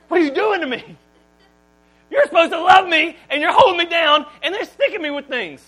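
put-on high, loud wail of a betrayed child; hurt child. Child held down for shots, wailing. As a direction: cry it out like a small kid.

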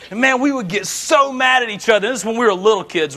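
usual speaking voice

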